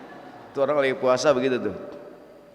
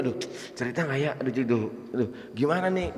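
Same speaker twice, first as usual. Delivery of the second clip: quick, much softer anxious fretting; worried fretting; 4 semitones lower, 10 dB quieter, much faster than usual. Acted. Preacher voices the mother fretting whether to tell, quick and hushed. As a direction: mutter the worry fast under the breath.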